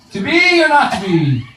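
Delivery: high, theatrical mock Shakespeare; hammed-up Hamlet. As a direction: soar up on "be" like a ham actor. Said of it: "I'd go".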